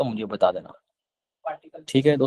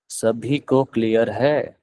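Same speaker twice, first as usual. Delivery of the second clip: "सभी को क्लियर है?" drawn out slowly, with a rise at the end; slow repeat; 4 semitones lower, much slower than usual. Shown as a demonstration. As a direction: ask it again slowly and deliberately. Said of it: "teacher repeats the question slower". (धीरे-धीरे, खींचकर दोबारा पूछा गया सवाल)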